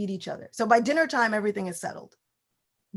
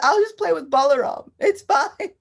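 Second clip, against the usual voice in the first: put-on sulky child; 7 semitones higher, 8 dB louder than usual. Child sulks about brother.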